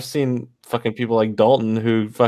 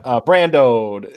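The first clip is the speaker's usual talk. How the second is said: drawn-out mock catchphrase; 4 dB louder, much slower than usual. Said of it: "comes over and goes".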